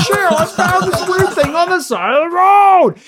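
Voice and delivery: high excited country voice; folksy old man. Pretend. Old driver tells wife.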